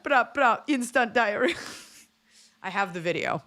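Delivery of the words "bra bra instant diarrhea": put-on Jamaican accent. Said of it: "then she went".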